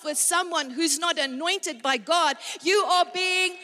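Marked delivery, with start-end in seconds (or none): 2.6-3.6 louder, higher preaching scold on foolish; forceful rebuke. Real, not acted. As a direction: call them foolish with force.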